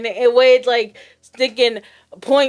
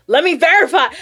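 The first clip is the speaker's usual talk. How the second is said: a touch of heated insistence, slightly louder and higher; retold anger; 4 semitones higher, 5 dB louder than usual. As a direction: snap it back, half-mad. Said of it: "I got mad".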